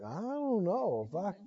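very soft, hesitant mock mumble; imitated doubt. Smoker looking doubtfully at the preacher, imitated hesitation. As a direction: trail off, badly unsure. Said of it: "looked at me like".

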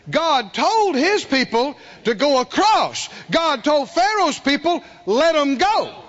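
high, punchy sermon delivery; forceful preaching. Real, not acted. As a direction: drive each line home to the congregation.

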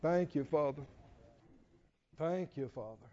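low, hushed thanks to God; quiet prayer. Real, not acted. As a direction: pray softly, fading almost to nothing. Closing prayer.

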